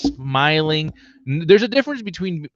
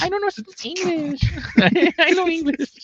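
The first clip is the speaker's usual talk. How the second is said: much higher squeaky voice with laughter; high pitch voice. Pretend. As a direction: squeak it out as the girl. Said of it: "we have the high pitch one".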